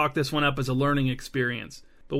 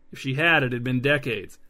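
plain reading voice

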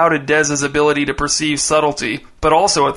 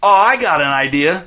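put-on a scheming kid's call; 3 semitones higher than usual. A narrator's reading. Dez calls a new plan to Jace, scheming voice. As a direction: call out a bright idea. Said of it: "Dez called to Jace".

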